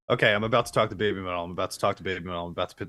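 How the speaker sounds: fast nervous chant, fading to a mumble; racing inner voice. Retold or imitated. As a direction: rattle it off to yourself, trailing away. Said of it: "inside your brain an element of".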